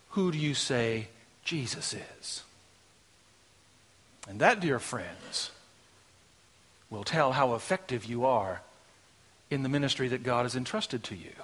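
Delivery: low, soft, solemn pulpit voice; hushed preaching. Real, not acted. Preacher asking dear friends who Jesus is, hushed preaching. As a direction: drop right down and let it sink in.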